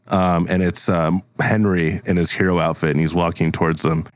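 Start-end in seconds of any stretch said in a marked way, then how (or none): none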